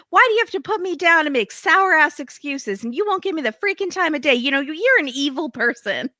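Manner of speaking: high-pitched, rapid-fire imitation of her tirade; mock angry rant. Woman who won't let her ex talk, mock angry rant.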